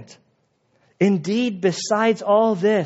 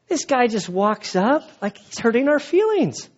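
incredulous disciples; 4 semitones higher than usual. Retold or imitated. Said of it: "They're like".

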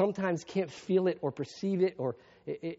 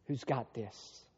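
ordinary delivery

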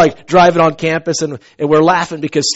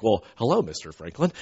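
suddenly respectful, softer greeting to the vice president; a touch of polite deference, quieter; deferential tone; 9 semitones lower, 10 dB quieter than usual. Retold or imitated. Greeting a vice president after recognizing him, mildly deferential. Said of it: "changing my tone towards this guy".

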